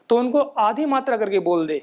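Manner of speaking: ordinary talk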